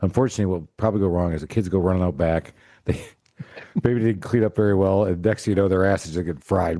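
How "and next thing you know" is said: ordinary delivery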